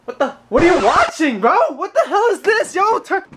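laughing disbelief at what's onscreen; shocked. Real, not acted. Finds friend on couch.

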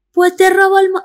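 high Andalusian bully voice; Andalusian accent. Retold or imitated. Imitating a TikTok bully threatening Eleven, Andalusian accent. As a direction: sneer it in a Sevillian accent. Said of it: "ellas sí que son andaluzas, lo estoy haciendo".

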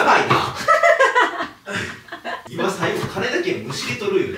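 laughing. Real, not acted. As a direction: talk through real laughter. Laughing at wife's line. (笑いながら)

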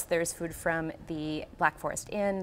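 ordinary talk, nothing marked

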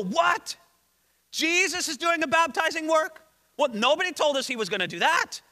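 mildly indignant surprise; upset disbelief. Acted. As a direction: act out disciples caught off guard. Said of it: "they are upset".

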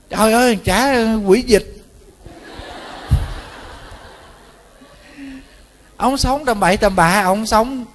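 high-pitched exclaiming rebuke; acted outburst of exasperation; exasperated scolding. Monk berates the cheating husband.

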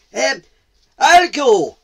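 loud, high offer after a hesitation; high-pitched, booming volunteer; volunteering leopard. Acted. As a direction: step forward as the leopard.

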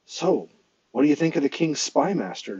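usual speaking voice

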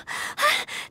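sharp surprised intake of breath; a quick startled gasp. Acted. Surprised gasp after thanks.

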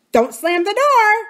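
high, loud, drawn-out parrot squawk; Kiki. A narrator's reading. Parrot calling from the passage at Aunt Polly, squawking. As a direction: call out a bossy parrot scolding. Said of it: "came Kiki's voice from the passage".

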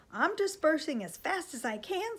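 high, loud, put-upon complaint; indignant protest. A narrator's reading. Sam objecting to the officer's order, indignant.

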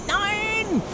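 very high squeal of no; high desperate squeal; shrieked no. Real, not acted. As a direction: shriek it in despair. Character dying in game.

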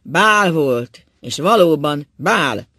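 loud, slow scornful jeer; loud, slow sarcasm before breaking down; sarcastic. A narrator's reading. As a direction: drag out the scorn loudly. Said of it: "szólt Ákos gúnyosan".

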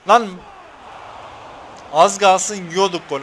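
slow loud relieved alarm; alarmed outburst. Real, not acted. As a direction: react to almost conceding. Near goal conceded in game.

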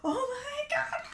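high, swooning exclamation; real gushing, pitch climbing high; overwhelmed delight. Real, not acted. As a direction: squeal it as if overcome. Reactor overwhelmed by the performance.

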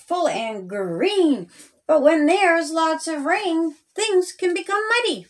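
ordinary delivery, nothing marked

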